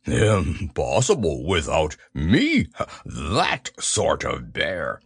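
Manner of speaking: lightly hammed-up proud bear; proud Pooh. A narrator's reading. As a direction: puff up proudly to yourself. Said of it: "said proudly to himself".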